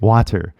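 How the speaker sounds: WATER with a crisp, audible T; hard-T WATER. Shown as a demonstration. Teacher repeats the learner's mistake, WATER with a hard T. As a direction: hit the T in WATER. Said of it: "you hear the T".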